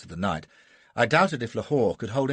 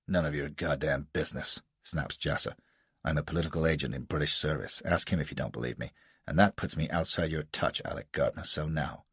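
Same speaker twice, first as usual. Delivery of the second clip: lightly voiced Jasser, lower, with a hint of American defiance; a cool, lower rogue brushing off the colonel; defiant American adventurer; 6 semitones lower than usual.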